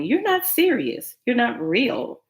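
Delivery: high, slow disbelief; you can't be serious; incredulous. Real, not acted. Disbelief at the guy.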